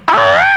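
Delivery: sudden high shrieking yell. Acted. Comic lover startling a partner with a shriek. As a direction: blurt out a piercing shriek. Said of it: "you go".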